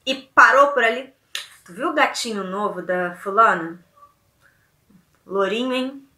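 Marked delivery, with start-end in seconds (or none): 1.6-6.1 sly gossip. Shown as a demonstration. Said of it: "a minha entonação vai diferenciar".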